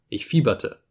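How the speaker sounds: ordinary delivery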